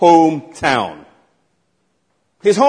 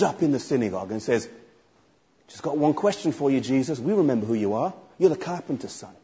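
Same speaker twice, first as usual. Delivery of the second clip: low taunting challenge; 4 semitones lower, 11 dB quieter than usual. Acted. Skeptic confronts Jesus in synagogue.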